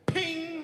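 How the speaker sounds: high ping sound for a coin; a high, light coin ping; mock coin ping. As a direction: chirp a high ping like a coin hitting the bar. Coin tossed on bar.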